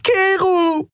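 very high, anguished call; tearful scream. A narrator's reading. Andriel runs after Keru in tears, screaming. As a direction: wail the name, high and drawn out. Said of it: "während heiße Tränen über ihr Gesicht liefen".

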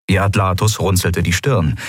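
plain reading voice

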